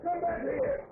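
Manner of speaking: high yells to a departing car; shouting after them. Acted. Hitchhiker yelling after the car that left him, high shout. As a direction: call out desperately as they drive off.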